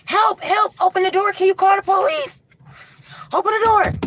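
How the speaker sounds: high loud pleading shouts; desperate calls for help. Retold or imitated. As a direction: beg through the door. Banging on cabin door begging.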